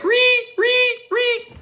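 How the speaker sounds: very high mock screech of the shower strings; Psycho violin screech. Hearing his mom scream as the movie music, piercing high screech. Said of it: "in my ears it sounds like".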